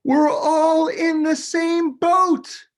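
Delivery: high, loud, slow holler. A narrator's reading. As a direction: Noah hollering to the restless animals. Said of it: "Noah took a deep breath and hollered".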